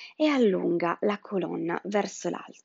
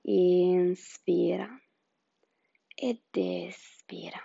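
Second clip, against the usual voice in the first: slow breathing cue; 4 dB louder, slower than usual. Acted.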